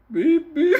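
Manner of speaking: high, loud beeps breaking into laughter; loud alarm beep. Retold or imitated. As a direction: blare like an alarm clock. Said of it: "like a fucking alarm clock".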